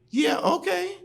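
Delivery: put-on high, loud, excited girlish voice; Liz's eager yes. Liz agreeing to come over, high and excited. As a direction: jump at the offer, high and bright.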